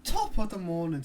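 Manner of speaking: put-on Irish accent, starting high and loud. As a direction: belt out a greeting in mock Irish. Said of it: "in an Irish accent".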